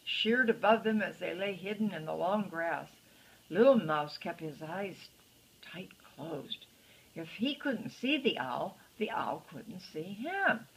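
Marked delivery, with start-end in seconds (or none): 4.2-6.7 hushed whisper. A narrator's reading. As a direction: breathe it out unvoiced. Scary owl overhead, unvoiced.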